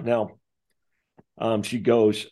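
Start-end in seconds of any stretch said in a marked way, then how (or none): none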